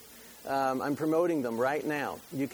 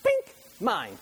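a light imitation of a movie villain's grab; mock villain claiming it; 6 semitones higher, 5 dB louder than usual. Preacher imitating Elmo movie villain, lightly voiced. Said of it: "he would go".

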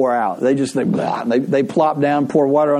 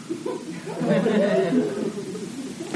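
laughter; 9 semitones higher, 8 dB quieter than usual. Real, not acted. Laughing at own punchline.

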